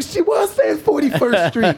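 high, loud, disbelieving yell; excited shout. Retold or imitated. Two friends realizing her address, shouting in disbelief. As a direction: shout it like it finally clicked.

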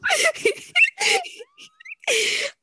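long high shrieking laugh. Real, not acted. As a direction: howl with helpless laughter. Laughing fit.

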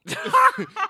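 big laugh; loud burst of laughter. Real, not acted. Interviewer to the teary guest, loud burst of laughter. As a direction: let out a loud real laugh.

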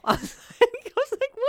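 very high, squeaky laughing voice; words squeezed out through laughter; laughing while talking. Real, not acted. Cracking up at the couple's LOL replies, laughing.

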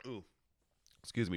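ordinary talk

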